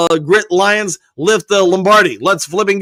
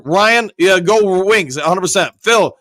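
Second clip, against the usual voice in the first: ordinary talk, nothing marked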